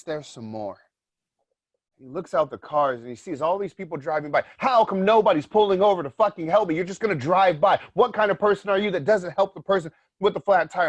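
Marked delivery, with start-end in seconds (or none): 4.6-10.9 higher, louder bitter complaining; stranded driver's angry rant at passersby; indignant driver. Retold or imitated. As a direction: resent the cars passing by. Stranded driver voiced angrily.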